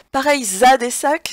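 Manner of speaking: "Pareil, za" with a loud Z linking into "à"; liaison. Shown as a demonstration. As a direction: sound the Z before "à" clearly. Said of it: "ce A c'est la préposition A".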